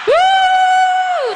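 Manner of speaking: very high, loud whoop; high cheering whoop. Real, not acted. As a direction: let out a big excited whoop. Cheering the crowd.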